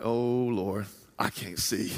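low, hushed mock dismay; worried muttering. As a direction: mutter a worried little prayer to yourself. Retelling being blind in dark woods.

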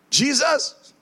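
high, ringing call on Jesus; high praying call laying hands on the lady; commanding prayer. Retold or imitated.

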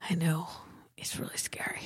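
hushed, spooked whisper. Retold or imitated. Replying to her sister after the crash, spooked whisper. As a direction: breathe it out like you're scared.

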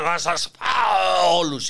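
slow, booming mock roar. A politician roaring at his party congress, slow booming mock roar. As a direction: roar it out slowly like a beast.

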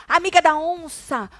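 high, drawn-out taunt; mock angry insult. Retelling Fu's insult in the fight, mock angry. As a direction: hurl it like a quarrelling rival.

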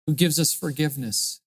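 low, soft praying voice; prayer. Real, not acted. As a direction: speak softly and low to God, in short phrases. Leads a closing prayer.

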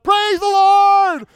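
slow, high, loud shouted praise. Retold or imitated. What the friend thought he heard at dawn, a slow high shout. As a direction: belt it out like a joyful holler. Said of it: "and you just shout out".

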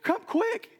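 gasping, very high-pitched urgent call; out of breath. Retold or imitated. Director bursting into the staff meeting, gasping out of breath. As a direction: imitate a breathless, panicked summons. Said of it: "She's, like, out of breath".

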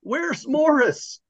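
put-on high-pitched, drawn-out call of a frantic old woman; panicked calling out. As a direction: call down the hall for someone, high and slow. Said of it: "runs up and down the hall calling out".

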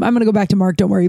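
ordinary talk

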